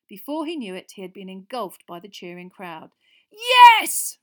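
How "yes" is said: loud high yell. A narrator's reading. Ron yelling in triumph at Harry, loud yell. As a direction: shout it to the sky. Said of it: "Ron yelled".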